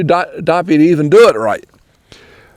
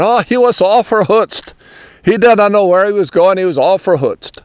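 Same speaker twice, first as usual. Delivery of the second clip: put-on Pennsylvania Dutch accent, higher, "verhutzt" said as fer-hootst; imitating the old Pennsylvania Dutch folk, a bit higher; 3 semitones higher than usual. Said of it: "they sort of have that accent".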